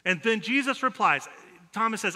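usual speaking voice

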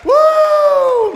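high, loud joyful whoop; ecstatic whoop. Real, not acted. Preacher whooping at his own story, ecstatic. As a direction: let the joy burst out.

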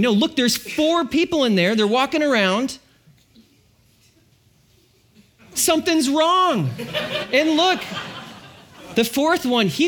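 breathless with disbelief, half laughing; amazed king. Retold or imitated. King telling advisors what he sees, high and amazed. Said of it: "he's amazed right now".

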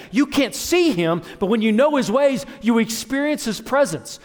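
high, loud, driving preacher delivery; forceful preaching. Real, not acted. Preacher telling the congregation why he teaches them to read, forceful.

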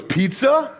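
a hint of a posh British prince, asking a question; British accent. Prince William asking an American student in the library, imitated British accent. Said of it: "Very different accent than mine".

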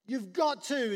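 half-shouted, slow insistence; desperate shouting. Retold or imitated. Official insisting Jesus come, shouting. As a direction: push hard, almost yelling. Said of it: "he's even getting a little bit".

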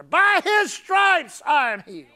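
loud, slow declaration; great big word. Retold or imitated. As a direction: proclaim it big and bold. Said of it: "this great big word in her".

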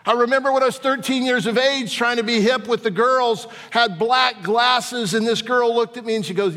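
high, loud preaching that breaks into a laugh; full-force preaching. Acted. Preacher tells school memory.

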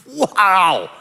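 drawn-out amazed wow; acted awe; awestruck wonder. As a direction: take in the world for the first time. Acting Adam seeing creation first time.

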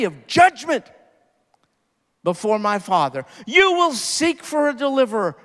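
preacher at full force, warning of judgment; fiery preaching. Acted. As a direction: press the warning home from the pulpit.

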